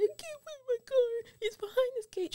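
high, mock-panicked yell at the intercom; retold drunk yelling. Drunk woman pleads with security over intercom, high mock yell. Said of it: "I was yelling at the person in the intercom".

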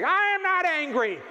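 high, loud, furious denial, hammed-up; mock angry scream. Woman answering why are you so angry, imitated furious scream. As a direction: scream the denial in comic outrage. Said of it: "she was screaming".

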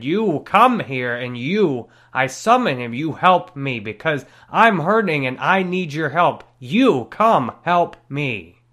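loud, emphatic summons in stretched-out words; summoning call. Retold or imitated. Man calling his dead dad back for help, insistent summons. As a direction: call out forcefully, landing hard on key words. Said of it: "I called him back to me".

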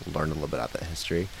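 usual speaking voice

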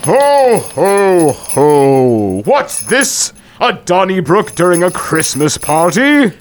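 Santa voice. Acted. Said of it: "Saint Nicholas came with a bound".

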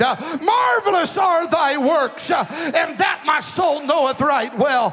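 loud, ringing scripture proclamation; fervent preacher reading the psalmist; preaching the psalm. Acted. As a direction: declare it to the crowd.

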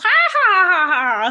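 loud, high imitation cackle; villainess cackle. Recalling the Power Rangers villainess, loud high cackle. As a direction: throw out a big mock villain cackle. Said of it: "the lady who cackles".

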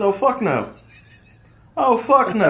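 high, rising indignant outburst; indignant refusal. Retold or imitated. Retold vow of revenge after taunt.

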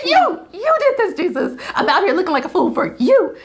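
high, fast, laughing whiny rant at Jesus; mock tantrum. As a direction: whine it out like a kid throwing a fit, half laughing. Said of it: "I had a big old tantrum".

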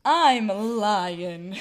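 very slow, drawn-out stage lion; stalling onstage as a silly lion; lion improvising. Acted. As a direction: stretch each word, hammed-up and dopey. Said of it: "That's what I would do".

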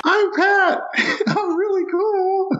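high, laughing mock brag; mock cool guy. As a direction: squeak out a goofy boast. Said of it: "Reading the script and my name".

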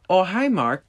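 high, cheery "Oh, hi, Mark"; hammed-up Wiseau greeting; bad Tommy Wiseau impression. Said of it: "my horrible impression of Tommy Wiseau".

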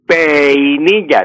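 "peinilla" stretched slowly as pe-i-ni-lla; diphthong split into hiatus. Shown as a demonstration. Professor shows the wrong peinilla, e held apart from i. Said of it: "ni puedo decir".

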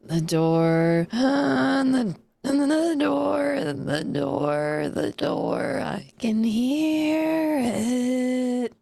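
slow, gasping, wavering creature voice; eerie drawn-out windy rasp, swooping high; raspy, windy shadow creature. Acted. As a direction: gasp between long, stretched words. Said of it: "you hear that long, raspy, windy voice".

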